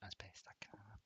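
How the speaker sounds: put-on whispered Scottish accent. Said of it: "full A S M R mode here while doing a Scottish accent".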